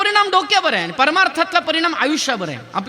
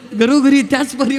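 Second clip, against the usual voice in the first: mock-amused quote with a laugh in it; laughing retelling; 6 dB louder than usual. Preacher quoting the audience's admission, laughingly. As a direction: imitate people saying it through laughter. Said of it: "ते हसत हसत सांगतात".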